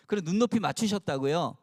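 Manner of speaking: usual speaking voice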